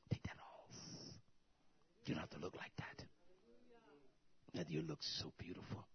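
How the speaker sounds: whispered, tender aside to a daughter; soft whisper. Acted. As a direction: breathe the words gently, almost unvoiced. Preacher speaking softly to daughter.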